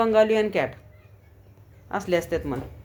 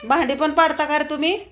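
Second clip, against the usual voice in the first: raised, higher calling voice; 6 semitones higher than usual. Real, not acted. Owner calling her cats across the house, raised voice.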